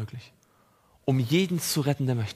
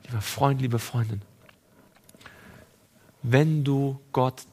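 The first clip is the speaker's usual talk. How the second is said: tender appeal; 4 semitones lower, slower than usual. Acted.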